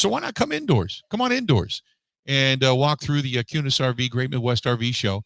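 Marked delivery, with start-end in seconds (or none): 0.0-1.8 upbeat invitation. Real, not acted. Host invites listeners to the show.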